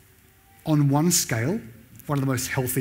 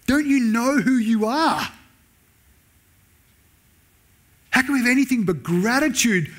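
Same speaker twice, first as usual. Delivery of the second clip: sincere pleading; 8 semitones higher than usual. Retold or imitated. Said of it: "The plain reading".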